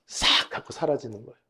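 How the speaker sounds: normal talking voice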